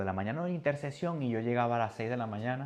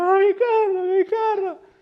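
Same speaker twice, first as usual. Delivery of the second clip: high, loud retold wail; wailing cry; 13 dB louder, slower than usual. Preacher retelling dream grief over his car, high wail. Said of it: "Yo llorando en el sueño".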